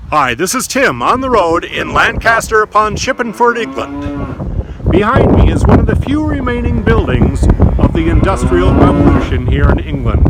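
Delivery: loud, high-pitched on-location presenter; a booming TV travel reporter; travel show host. Acted. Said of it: "I have another Tim Travels from England".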